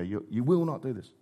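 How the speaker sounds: retelling Peter's words to his king, hushed; incredulous hush. Retold or imitated. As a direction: say it low, shocked at the nerve. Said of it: "Hang on, King Jesus talking to Peter".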